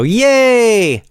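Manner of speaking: high, loud mock cheer; bigger yay. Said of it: "A little more".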